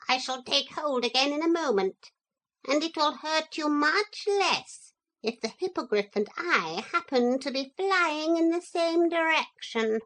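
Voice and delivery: high, even, polite threat; coolly menacing parrot. A narrator's reading. Parrot to the motor lady, polite menace. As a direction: explain calmly who holds the power.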